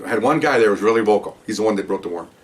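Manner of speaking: ordinary delivery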